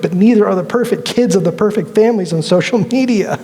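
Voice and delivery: ordinary talk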